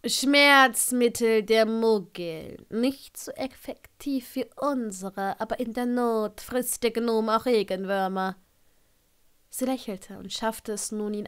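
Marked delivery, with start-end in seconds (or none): 0.1-8.4 slow, drawn-out character voice; dry, drawling Severus. Acted. Severus explaining Muggle medicine to a patient, dry slow drawl. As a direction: draw the words out with dry disdain.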